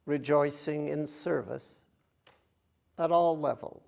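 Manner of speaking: measured phrases with long, spacious pauses; unhurried contemplative recitation, phrase by phrase; guided meditation. Acted. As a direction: guide them gently, leaving silence between phrases. Leads a meditation.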